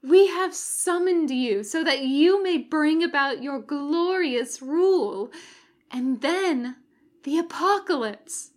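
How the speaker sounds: loud, high and exultant. Acted. Girl greets the summoned demon with loud exultant triumph. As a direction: proclaim it in triumph. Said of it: "the girl's voice was exultant".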